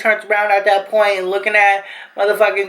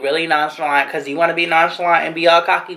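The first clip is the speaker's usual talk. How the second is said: ordinary delivery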